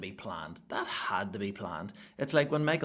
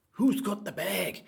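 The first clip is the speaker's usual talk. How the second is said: higher, louder and slow chant; called out high; 11 semitones higher, 6 dB louder, slower than usual. Shown as a demonstration. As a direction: call it out to the crowd, drawn out. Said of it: "repeat in higher voice".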